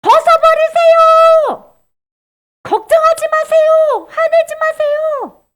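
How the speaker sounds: high, loud, drawn-out exhortation; urging commands. Acted. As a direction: call each command out slowly and forcefully. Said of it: "성령님이 그렇게 얘기하시는 거야".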